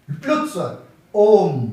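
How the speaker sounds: ओम held long and loud; drawn-out vowel. Shown as a demonstration. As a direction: stretch ओम out like a chant. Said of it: "तीन गुना समय लगता है". (ओम को लंबा खींचकर बोलना)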